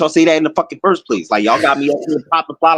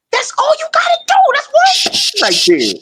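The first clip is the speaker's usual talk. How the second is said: much higher, fast put-on voice sealing the deal; mock deal-maker voice. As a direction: squeak it out fast like a smug dealmaker. Voicing himself making peace deal.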